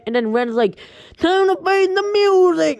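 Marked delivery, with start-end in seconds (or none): 1.2-2.8 much higher, loud hammed-up villain line; villain threat. Ren taunting the boy before the meatballs, high loud mock threat. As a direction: play the cartoon bad guy gloating.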